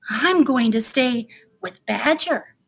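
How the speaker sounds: plain reading voice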